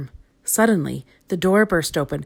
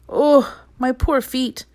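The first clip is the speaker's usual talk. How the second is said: higher, drawn-out weary moan; tired moan; 7 semitones higher, 3 dB louder, slower than usual. A narrator's reading. Mister Clumsy moaning about his sore feet, weary. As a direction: complain about aching feet. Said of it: "he moaned".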